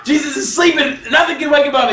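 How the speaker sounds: high, shouted preaching; preacher shouting the point; excited shout. Real, not acted. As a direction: push it out with energy. Excited sermon emphasis.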